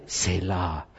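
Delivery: put-on "Selah" said low and soft, drawn out with a grand foreign accent; heavily accented Selah. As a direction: intone "Selah" deep and hushed like a mock chant. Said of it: "but with a lot of accent".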